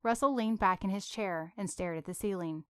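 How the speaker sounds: usual speaking voice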